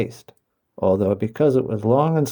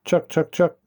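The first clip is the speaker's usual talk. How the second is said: higher clucking call to the chicks; hen calling chicks; 6 semitones higher than usual. A narrator's reading. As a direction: coax the chicks in like a mother hen. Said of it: "she would call".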